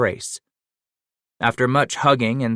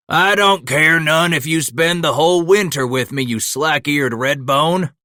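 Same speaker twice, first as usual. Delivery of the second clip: higher, louder, teasing shout; shouting back; 7 semitones higher, 5 dB louder than usual. A narrator's reading. Mateo shouting a teasing reply back to Scratch. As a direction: bellow a friendly insult back. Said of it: "Mateo shouted back".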